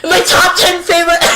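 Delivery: high, loud, strangled croak; dying sick voice. Retold or imitated. Mocking their own sick top-ten recording, hammed-up dying voice. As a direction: exaggerate a choking, dying voice. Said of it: "I sound like I'm gonna die".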